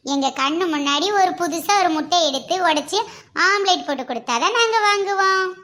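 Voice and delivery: usual speaking voice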